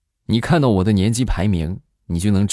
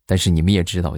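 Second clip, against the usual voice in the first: normal talking voice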